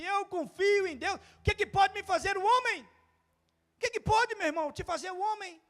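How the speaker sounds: high, loud defiant cry; defiant, preached high and loud; David's defiance. Retold or imitated.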